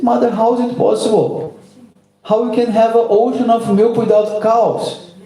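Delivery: put-on a small boy pressing his mother; child Krishna. A narrator's reading. Little Krishna asks his mother, in a high eager child's voice.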